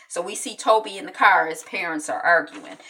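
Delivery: ordinary talk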